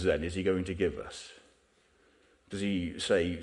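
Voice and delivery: normal talking voice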